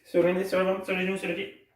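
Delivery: quick, high, run-together list. Shown as a demonstration. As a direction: rattle the body parts off. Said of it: "Encore plus vite".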